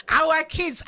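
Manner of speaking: hammed-up cry of disbelief; exasperated shout. Husband's reply, laughing retelling.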